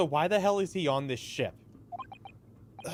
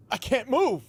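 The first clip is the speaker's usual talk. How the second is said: high panicked yelp, louder; panicked cry; 11 semitones higher, 5 dB louder than usual. Acted. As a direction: shout it as if frozen in place. Voicing panicked game hero.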